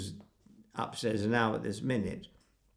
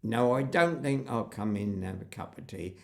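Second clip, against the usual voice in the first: West Country countryman declining politely; imitated West Country speaker; West Country accent; 3 semitones lower than usual. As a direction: put on a rustic West Country burr. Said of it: "Afraid I'm using a West Country accent".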